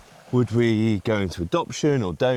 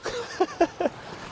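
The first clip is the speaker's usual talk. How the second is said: breathy laugh; 4 dB quieter than usual. Real, not acted. Unvoiced high laugh.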